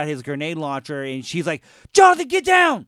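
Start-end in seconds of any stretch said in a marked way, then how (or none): 1.9-2.9 a high, loud yell of warning; shouted warning. Retold or imitated. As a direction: scream it like ducking an explosion. Sarah yelling warning in movie.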